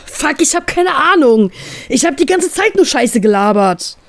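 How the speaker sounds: high-pitched, worked-up rant; loud exasperated outburst. Acted. Captain admits he botched talking to her, loud agitated outburst. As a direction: blow up in exasperated desperation, pitch shooting up. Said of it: "regt er sich lautstark auf".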